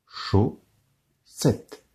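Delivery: Chaussette, chau and sette apart; split into syllables. Shown as a demonstration. Teacher to class, Chaussette split into syllables. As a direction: count out Chaussette in two beats. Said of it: "Un doigt pour chaud".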